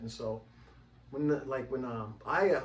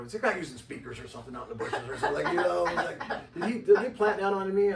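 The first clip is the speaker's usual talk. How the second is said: fast, laughing rising wonder; hearing humming by the river, laughing and louder; laughing disbelief; 6 semitones higher, 9 dB louder than usual. Retold or imitated. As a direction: tumble out the suspicion through laughter. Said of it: "I go".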